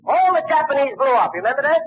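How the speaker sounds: high, shouted triumph with a rising question at the end; excited shout. Retold or imitated. Fan triumphantly asking Bogart if he remembers, shouting high. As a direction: cheer the hero's victory. Peak of retold battle scene.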